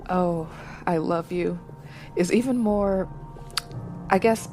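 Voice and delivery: usual speaking voice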